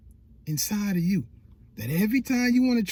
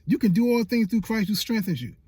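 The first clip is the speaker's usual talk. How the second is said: ordinary talk, nothing marked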